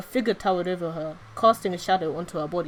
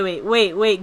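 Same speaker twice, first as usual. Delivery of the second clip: plain reading voice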